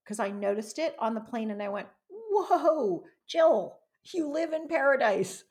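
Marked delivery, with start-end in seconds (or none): 2.1-5.4 high, loud self-directed wake-up call; retold amazed exclamation. Speaker recalling her wake-up moment on the plane, amazed. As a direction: exclaim it as if suddenly waking up to it.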